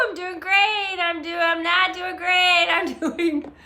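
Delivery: high, loud, wavering put-on cheer; mock frantic cheer. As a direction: act out barely holding it together, high-pitched. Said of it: "Is anybody else going like this?".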